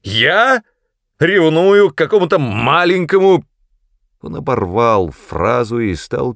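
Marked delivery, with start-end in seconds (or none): 0.0-3.4 loud, high-pitched outraged disbelief; sputtering indignant questions; indignant explosion. A narrator's reading. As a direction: explode at the accusation, voice shooting up. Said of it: "взорвался Ас".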